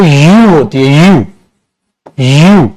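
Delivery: यू as one slow syllable with a y glide; said like "you". Shown as a demonstration. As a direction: model यू slowly, exactly like the word "you". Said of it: "जो इसकी pronunciation होती है". (यू, धीरे, "य" की आवाज़ से शुरू)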